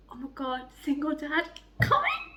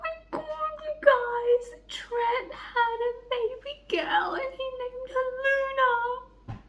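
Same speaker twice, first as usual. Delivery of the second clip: teary sing-song; 12 semitones higher, 3 dB quieter, slower than usual. Real, not acted. Emotional sing-song announcement.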